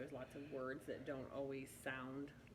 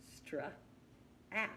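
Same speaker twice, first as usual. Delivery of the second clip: "Stra-" and "at" said as separate beats; syllables split; 5 semitones higher than usual. Shown as a demonstration. Teacher models splitting the word into syllables. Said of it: "syllables broke up".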